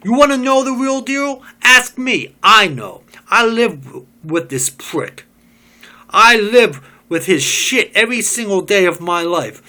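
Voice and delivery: loud, high, bitter tirade; bitter rant. Acted. Job ranting to the group about Smith, loud and bitter. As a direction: spit out the resentment.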